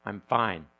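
low, pointed I'm fine meaning not fine; loaded fine. Retold or imitated. Asked the same question, the other person answers with a pointed fine. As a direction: say fine so it means trouble. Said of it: "the response is".

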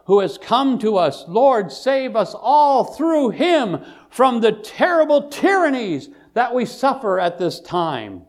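loud, high, drawn-out plea to heaven; full-force preaching. Acted. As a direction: cry out a prayer from the pulpit.

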